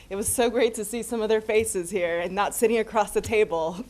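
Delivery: usual speaking voice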